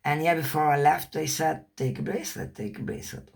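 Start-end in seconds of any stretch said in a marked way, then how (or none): 2.6-3.3 whispered repeat. Retold or imitated. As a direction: take a bracelet whispered soft and low, unvoiced. They urge her twice.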